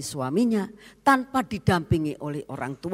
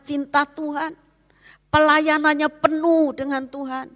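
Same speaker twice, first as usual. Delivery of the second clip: higher and louder rising sermon cry; higher, louder preaching; impassioned preaching; 8 semitones higher, 5 dB louder than usual. Acted. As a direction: build the crowd's feeling. Preacher builds to climax.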